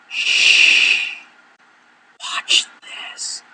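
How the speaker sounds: conspiratorial unvoiced whisper; shush, then whisper it slyly to a coworker; hushed aside. Hand up to my mouth and go.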